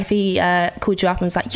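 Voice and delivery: ordinary delivery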